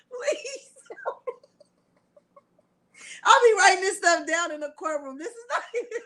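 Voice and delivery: high, helpless laughter; laughing too hard to read. Real, not acted. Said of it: "if I'm laughing".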